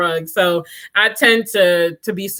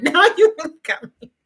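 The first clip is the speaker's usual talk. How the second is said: laughing; 8 semitones higher, faster than usual. Real, not acted. Laughing exasperation.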